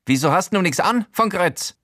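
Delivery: high, disapproving Showalter voice. Acted. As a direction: scold him for being naked. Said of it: "fragt Showalter missbilligend".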